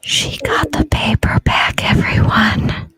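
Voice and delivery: whispering. Real, not acted. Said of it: "if I whisper in it".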